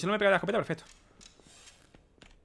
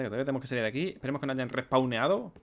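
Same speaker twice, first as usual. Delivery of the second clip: ordinary delivery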